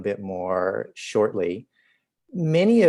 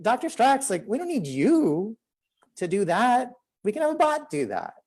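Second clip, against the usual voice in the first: much higher-pitched quote of a colleague; eager retold pitch; 13 semitones higher than usual. John pitching a bot to the doctor, eager and high-pitched. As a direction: imitate a colleague pitching an idea, voice lifted high.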